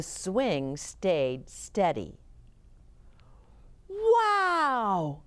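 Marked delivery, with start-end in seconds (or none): 3.9-5.2 high, drawn-out wow; amazed cry. A narrator's reading. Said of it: "the boys cried".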